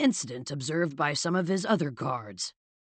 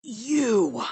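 rasping; 7 semitones higher, 3 dB louder than usual. Acted. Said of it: "rasped out just one word".

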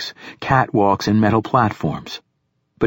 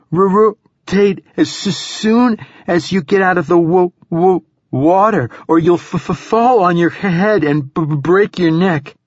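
high-pitched shivering warning, stuttering on first sounds; teeth chattering; 10 semitones higher, 4 dB louder than usual. A narrator's reading. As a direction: warn someone while shaking with cold. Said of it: "teeth chattering now despite her efforts".

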